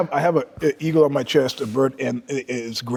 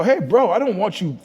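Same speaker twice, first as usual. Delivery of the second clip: higher, louder mock objection; retold protest; 8 semitones higher, 5 dB louder than usual. Client objecting to a tattooist who wants drugs, raised protest. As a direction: push back at him, pitched up.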